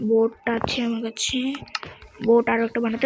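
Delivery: ordinary talk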